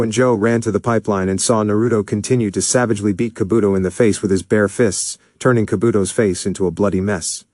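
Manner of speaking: plain reading voice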